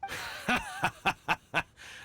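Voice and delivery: big high proud laugh; hearty laugh. Acted. Uncle laughing proudly at the kid's resolve. Uncle cheering Razor's decision.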